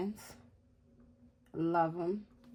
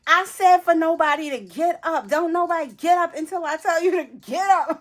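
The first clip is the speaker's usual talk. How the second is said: higher, louder scolding over the radio; strict attendant announcement; 9 semitones higher, 7 dB louder than usual. Retold or imitated. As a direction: lay down the law to the whole cabin.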